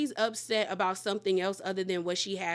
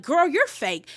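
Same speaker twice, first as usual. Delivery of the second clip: louder, higher retort imitating an argument; mock yelling back; 5 semitones higher, 7 dB louder than usual. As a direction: throw the accusation back, louder. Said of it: "yelling back".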